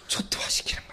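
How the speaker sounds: hushed whisper. Real, not acted. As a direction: whisper it like a secret. Secretly describing the mess.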